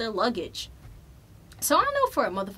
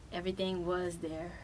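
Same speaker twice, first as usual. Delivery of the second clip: ordinary talk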